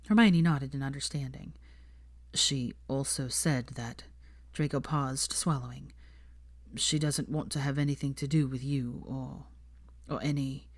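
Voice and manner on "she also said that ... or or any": heavy sighing. A narrator's reading. Draco reluctantly tells Hermione his mother's words, quiet and heavy. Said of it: "he sighed heavily".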